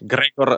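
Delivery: "Gregor" said kind of like German; German-like pronunciation. Shown as a demonstration. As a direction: give "Gregor" a German-style sound. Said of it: "should sound kind of like German".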